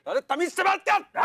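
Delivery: very high indignant yell; outraged shout. Acted. Insulted man demanding some manners, high shout. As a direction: explode at the insult.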